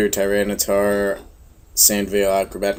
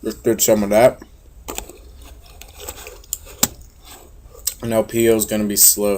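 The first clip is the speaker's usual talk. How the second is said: talking while chewing; words round a bite of sandwich; mouth full. Real, not acted. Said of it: "talking with, like, food in my mouth".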